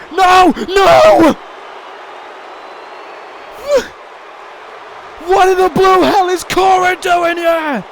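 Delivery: loud, high, disbelieving yell; real furious shout; outraged shout. Real, not acted. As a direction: scream at the screen in outrage. A shock interference in the match.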